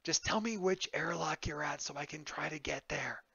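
hushed, urgent request; half-whispered plea. Acted. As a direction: beg quietly over the comms, keeping hidden. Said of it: "kind of a whispered".

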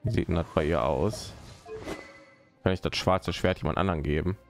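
normal talking voice

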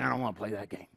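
low, mumbled sulky whine; sulky teenager. Retold or imitated. Teen complaining about the game, low sulky mumble. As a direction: grumble it like a bored teen.

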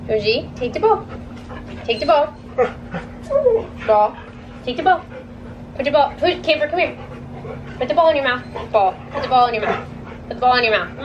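patient but insistent training voice; dog commands. Real, not acted.

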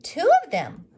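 put-on boy adding details about the sheep; eager little boy. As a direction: chime in excitedly. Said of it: "added Thomas".

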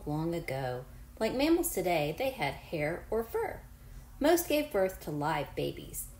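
clear, even read-aloud voice for a class; reading to children. Acted. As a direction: read the book to your pupils. Reads book aloud to class.

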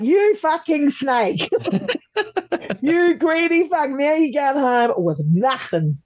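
high, slow, laughing mock outrage at a traitor; half-laughing fury, drawn out high. Reliving the vote, she mock-scolds the betrayer with laughing outrage. Retelling angry outburst at betrayer.

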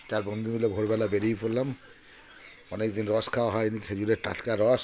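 usual speaking voice

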